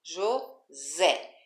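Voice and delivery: "José" split, with the ZÉ emphasized; teacher's demo of "José", jo... ZÉ; stress on last syllable. Shown as a demonstration. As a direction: punch the final syllable of "José". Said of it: "a última sílaba de cada palavra".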